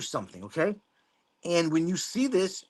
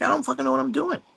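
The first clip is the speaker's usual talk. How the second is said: mock clueless newbie; 10 semitones higher, faster than usual. Shown as a demonstration. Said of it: "sometimes say".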